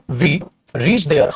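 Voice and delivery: we reached there, word by word, very slow; dictating "we reached there" at a crawl; slow dictation. Shown as a demonstration. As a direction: spell out "we reached there" slowly for students to copy.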